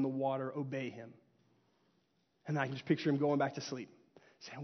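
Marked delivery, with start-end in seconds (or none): none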